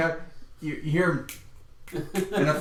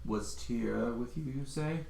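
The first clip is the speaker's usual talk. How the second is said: much softer, slow questioning; quiet suspicious NPC; 4 semitones lower, 12 dB quieter, slower than usual. Acted. The official quietly questions the players. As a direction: ask it softly, slow and suspicious. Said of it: "he goes, he goes".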